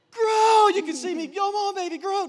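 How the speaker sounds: loud, high cheering yell; yelling at a seed. Retold or imitated. Counselor has Mike yell encouragement at a seed, loud high yell. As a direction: cheer the seed on like a coach at a race. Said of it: "Keep yelling at it".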